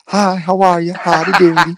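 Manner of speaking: high, lilting Southern twang, hammed up; campy high Texas drawl greeting; mock effeminate Southern dude. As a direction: play a prissy Southern good ol' boy. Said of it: "it's a high voice, pretty effeminate".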